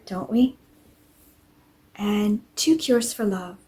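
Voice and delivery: ordinary delivery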